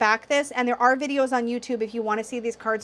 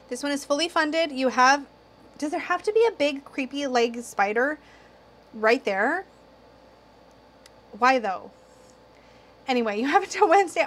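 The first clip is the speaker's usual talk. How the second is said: ordinary delivery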